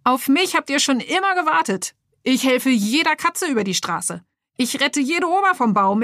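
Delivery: high, jerky toy superhero voice; boastful superhero. Acted. Action figure brags to Lenny and the workers, high and jerky. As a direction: brag like a freshly woken action figure.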